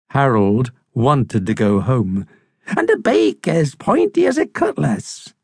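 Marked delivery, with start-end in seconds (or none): 2.8-5.3 high, swooping bird voice; old crusty seabird, ominous. Acted. Seabird finishes describing the monster, high and ominous. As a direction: pile on the dread in a high voice.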